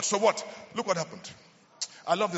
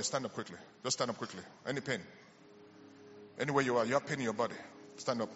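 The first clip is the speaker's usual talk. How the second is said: quiet ministry call; 2 semitones lower, 9 dB quieter than usual. Acted. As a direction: draw the sick forward gently and softly.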